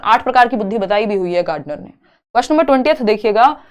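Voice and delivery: ordinary talk, nothing marked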